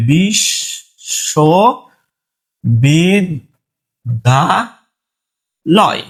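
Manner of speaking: বিশ্ববিদ্যালয় stretched very slowly, each syllable pulled long and apart; drawn out syllable by syllable. Shown as a demonstration. Teacher showing students the Banglish way, very slowly drawn out. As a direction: spell বিশ্ববিদ্যালয় out by drawing every sound far out. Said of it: "এই যে এইভাবে".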